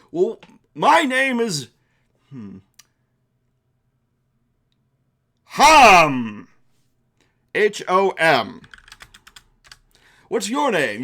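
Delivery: welcoming storybook creature, only slightly put on; a helpful accordion dragon offers aid, lightly voiced; lightly voiced friendly dragon. Dragon introduces himself.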